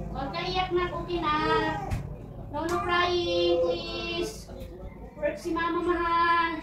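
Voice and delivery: drawn-out sing-song coaxing. Real, not acted. Soothing baby while working. (malambing na pagpapatahan)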